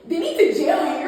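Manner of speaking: high, loud mock outrage, half laughing; imitated outraged mom. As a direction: scold like an appalled mom, laughing underneath. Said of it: "My mom called me yelling".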